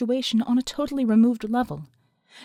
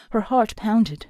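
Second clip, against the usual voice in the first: usual speaking voice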